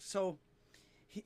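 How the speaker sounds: ordinary delivery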